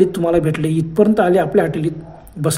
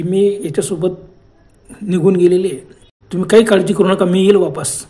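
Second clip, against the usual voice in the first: ordinary delivery, nothing marked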